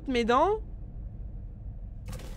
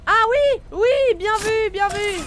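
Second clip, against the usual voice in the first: excited; 5 semitones higher, 6 dB louder, slower than usual. Real, not acted. Excited reaction to chat tip.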